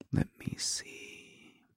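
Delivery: unvoiced, slow whisper to himself; whispered stall. Husband stalling before wife's question, slow whisper. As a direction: whisper it while stalling for time.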